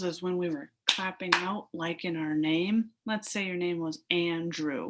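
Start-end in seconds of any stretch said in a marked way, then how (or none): none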